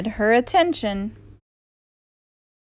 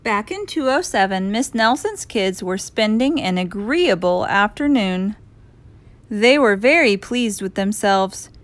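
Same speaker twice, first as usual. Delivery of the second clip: ordinary delivery, nothing marked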